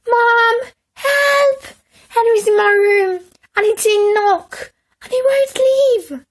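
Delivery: high, loud tattling cry; little brother shrieking for Mum, tattling; calling for help. A narrator's reading. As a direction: yell it down the stairs for Mum. Peter yelling for Mum.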